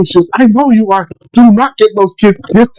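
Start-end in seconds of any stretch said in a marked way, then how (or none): 0.3-1.1 scolding wife. Retold or imitated. Wife's line, then echoed. 1.3-2.7 scolding wife. Retold or imitated. Wife scolding dad by phone.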